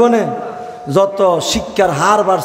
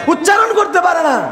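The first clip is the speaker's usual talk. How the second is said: emphatic shout; 12 semitones higher than usual. Shown as a demonstration. Preacher repeating the line to drive it home, shouting. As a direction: yell it back at the crowd for emphasis.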